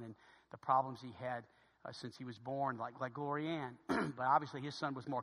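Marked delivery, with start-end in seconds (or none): none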